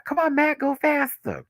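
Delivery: high-pitched playful call; mock urging. Vlogger calling to someone mowing outside, high mock urging. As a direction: hurry someone along in a high voice. Calling to the person cutting grass.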